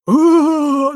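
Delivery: high, loud hooting ghost moan; a cartoony spooky wail, held long; cartoon ghost ooh. Retelling the ghost noise heard with his wife: a mock cartoon-ghost ooh. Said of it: "it sounds like a cartoon ghost".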